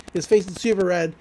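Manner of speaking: normal talking voice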